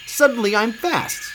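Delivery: high-pitched thrilled discovery; excited exclamation. A narrator's reading. Ketchup discovers his speed on skates, excited exclamation. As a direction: exclaim it high, amazed at yourself. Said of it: "shouted Ketchup".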